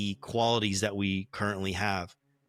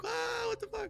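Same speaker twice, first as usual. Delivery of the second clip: very high-pitched mock astonishment; shocked onlookers; 10 dB quieter than usual. Game master voices people reacting to the fight, shocked. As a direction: play a stunned poolside crowd, way up high. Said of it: "people probably are starting to be like".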